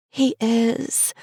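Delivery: Cherry's voice. Acted.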